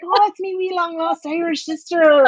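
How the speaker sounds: high, hammed-up Irish vendor voice; mock Irish accent. Vendor greeting his long lost sister, mock Irish accent. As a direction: exclaim it in a playful stage-Irish accent. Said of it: "he's Irish".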